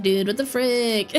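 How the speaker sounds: ordinary talk, nothing marked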